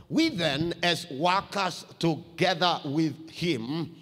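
measured Bible reading; scripture reading. Acted. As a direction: read it out to the church. Reads scripture to the congregation.

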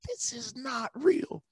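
very high, slow, drawn-out disbelief; freaking out. Retold or imitated. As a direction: stretch it out like you're in a dream. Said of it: "I'm still freaking out".

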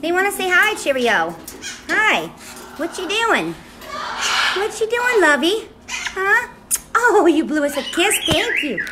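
high, sing-song doting voice. Real, not acted. Greeting Cheerio for the fans, high doting baby talk. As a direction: pitch it up and coo to a pet.

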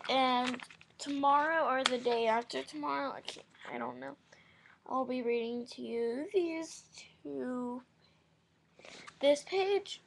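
usual speaking voice

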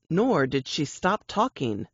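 ordinary delivery, nothing marked

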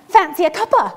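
cheeky Scottish gran popping up; Scottish accent, playful and loud; Scottish grandma. Retold or imitated. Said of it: "And she's like".

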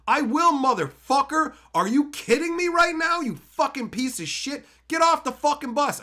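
high, loud, escalating outrage; furious confrontation, played up for the retelling; acted angry tirade. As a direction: tear into him at full indignation. Imagined tirade at bus smoker.

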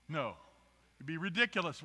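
ordinary delivery

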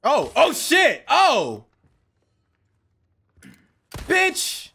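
high startled yelp; startled cursing. Real, not acted. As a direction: jump and swear in shock. Startled by enemy attack.

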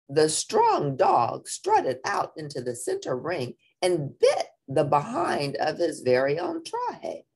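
usual speaking voice

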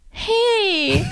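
high, stretched-out hey; imitating a friend's high sing-out hello; friend's greeting. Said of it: "and she goes".

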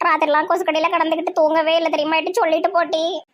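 ordinary talk, nothing marked

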